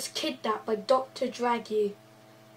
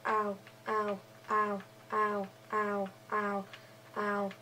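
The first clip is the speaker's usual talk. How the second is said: pained ows; 3 semitones lower, 9 dB quieter than usual. Acted. Pushed into ravine, hitting head.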